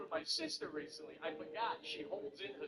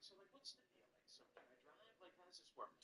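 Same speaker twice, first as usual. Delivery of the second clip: much softer, puzzled asking; hushed confusion; 4 semitones lower, 20 dB quieter than usual. Retold or imitated. Asking a friend after beers, hushed and unsure. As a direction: whisper it like asking a secret. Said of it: "quiet confused question".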